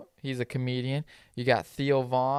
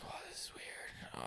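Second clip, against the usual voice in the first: whisper; 21 dB quieter than usual. Retold or imitated. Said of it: "whisper heard".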